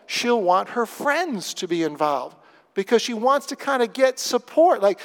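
ordinary talk

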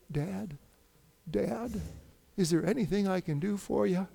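soft imitation of a worried son; softly voiced, tentative offer of help; quiet concern. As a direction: gently check on someone sick, voice hushed. Imitates son checking on sick father.

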